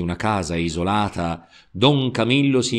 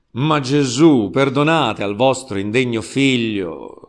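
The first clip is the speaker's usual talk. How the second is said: plain reading voice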